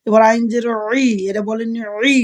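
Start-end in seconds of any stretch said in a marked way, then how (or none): 2.2-2.2 ই from a squeezed throat; throat ain. Shown as a demonstration. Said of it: "গলা চিপা দিয়ে করবেন ই". (গলা চিপে আইনের ই)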